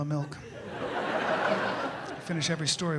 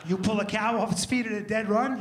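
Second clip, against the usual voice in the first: normal talking voice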